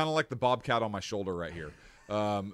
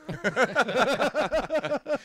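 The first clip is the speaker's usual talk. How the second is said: high, loud cackling laugh; real burst of laughter; 12 semitones higher, 7 dB louder than usual. Real, not acted. Host reacting to the chainsaw joke, real loud laughter. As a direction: crack up in a long, loud peal.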